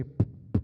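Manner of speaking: voiceless popped P sounds; beatbox lip kick. Shown as a demonstration. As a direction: pop the lips like a kick drum. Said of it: "you're not saying per anymore".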